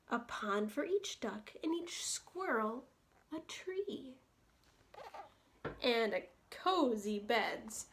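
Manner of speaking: gentle, lilting read-aloud for little ones; bedtime story reading. A narrator's reading. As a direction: read it like a cozy bedtime book. Reading picture book to children.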